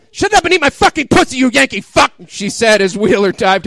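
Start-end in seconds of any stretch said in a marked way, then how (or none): 0.1-2.1 high, loud hammed-up Russian girl; higher, louder, faster mock demand; mock Linka yell. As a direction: bark it out like a bossy heroine. Linka shouting at Wheeler.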